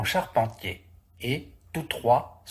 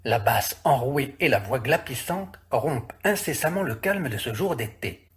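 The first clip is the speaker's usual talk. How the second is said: usual speaking voice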